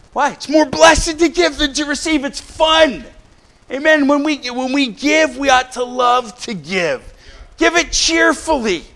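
high-pitched, exuberant exhortation; excited preaching. Real, not acted. Preacher urging the church that giving is fun, excited and high.

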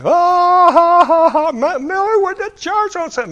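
high, loud jeering cackle over the words; mocking laugh. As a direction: crow it out, mocking and delighted. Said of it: "he just started broadcasting it to all the guys".

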